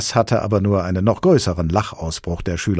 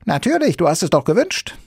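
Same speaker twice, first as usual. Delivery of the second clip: high, louder creature voice; Sams explaining; 13 semitones higher, 6 dB louder, faster than usual. Acted. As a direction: explain eagerly in a squeaky voice. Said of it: "antwortete das Sams".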